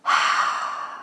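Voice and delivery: long voiceless "haa" of breath; soft open-throated huff; breathy exhale. Shown as a demonstration. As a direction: breathe out as if fogging cold air. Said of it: "往空气里面哈气".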